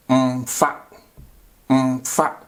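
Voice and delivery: Mfa with a hummed m before fa; Mfa, a short nasal m hummed straight into fa; say the negative form. Shown as a demonstration. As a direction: Twi word Mfa said clearly. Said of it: "I just place or prefix it with what? M".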